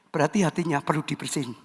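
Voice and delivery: low and soft, half to himself; soft aside. Real, not acted. Preacher first says it softly to the congregation. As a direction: drop it quietly, almost muttered. No reply, repeats after Halo.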